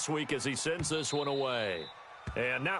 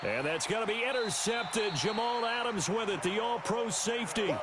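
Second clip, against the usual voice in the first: raised pitch, urgent big-play call; excited play-by-play; 5 semitones higher than usual. Real, not acted. Commentator announces an interception, excited. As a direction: call the turnover as it happens.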